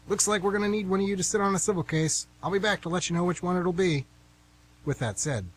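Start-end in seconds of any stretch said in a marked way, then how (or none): 0.1-4.0 higher, louder official announcement; brisk court clerk calling to the room. Acted. Clerk addresses jurors.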